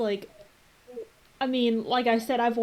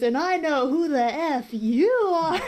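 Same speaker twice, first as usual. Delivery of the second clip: put-on drunk guy; 5 semitones higher, 4 dB louder than usual. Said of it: "He goes".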